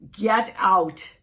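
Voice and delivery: loud, sharp shout; angry cat shout. Acted. Mother cat chasing the dog from her kittens, shouting. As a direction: drive the dog out with a yell. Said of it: "Eloise said".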